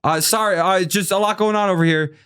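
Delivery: high, flustered, suddenly sweet director; fawning backpedal. Retold or imitated. Director backpedaling to Robin Williams, high and fawning. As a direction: grovel in a high flustered voice, fake cheerful.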